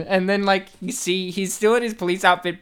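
usual speaking voice

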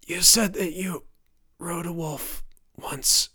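whispery, broken, raspy whisper; dying rasp. A narrator's reading. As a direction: eke out the words in failing breaths. Dying father speaks to his son.

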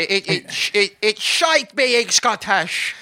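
put-on slow, broad Scottish accent. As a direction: lay on a thick Scottish accent. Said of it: "how do you do a Scottish accent".

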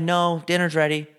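slow, stern parent; a hint of a strict mom. Acted kid and parent skit.

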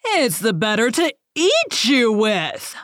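slow, high-swooping menacing growl; villainous wolf growl. A narrator's reading. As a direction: pounce on the words as the hungry wolf. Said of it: "growled the wolf".

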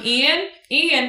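"Ian, Ian" tossed out lightly; casual calling. Shown as a demonstration. [name] pretending to casually look for her nephew, relaxed. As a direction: call the name offhand, like nothing's wrong. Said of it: "As opposed to going".

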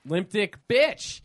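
loud, drawn-out "BITCH" rising at the end; hammed-up, slowed-down name-calling; mock insult. Podcast host echoing wife's accusation against husband, mock insult.

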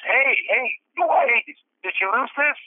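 high calling voice to get attention. Retold or imitated. Stranger calling out to return the fuel card, high. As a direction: hail him across the lot. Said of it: "this guy goes".

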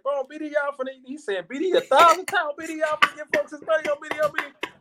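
rapid high-pitched street rant; voicing Courtney, fast and high; high excited quote.

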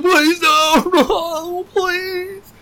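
high, whimpering plea with a sob at the end; mock begging. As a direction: beg like a caught man about to cry. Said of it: "He was crying".

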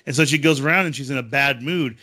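ordinary delivery

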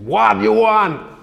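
a hint of a gruff American man shouting back; lightly hammed American voice calling from another room; American accent. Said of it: "I'm not gonna do any more American accents".

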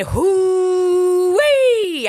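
loud, high, drawn-out hoo-wee; high hoot of pain. Retold or imitated. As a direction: let out a long high wince. Recalling getting winded.

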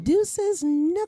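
very high, drawn-out farewell call; playful high sign-off; high-pitched sign-off. Pretend. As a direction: stretch it out way up high.

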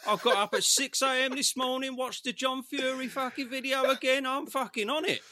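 high, loud laughing boast; excited laughing brag. Real, not acted. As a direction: crow about it, laughing. Laughing boast about preparation.

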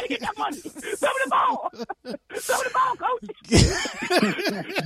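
high, whiny begging player voice; mock Antonio Brown. Player begging his coach on the sideline, high whiny mock. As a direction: whine for the ball like a sulking receiver.

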